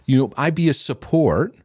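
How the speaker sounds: halting high youth-pastor reply; awkward hesitant reply. Retold or imitated. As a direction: stumble as if caught off guard. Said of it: "And I'm like".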